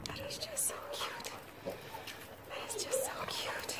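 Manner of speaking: soft whispered awe; whispering over the cute puppies. Real, not acted.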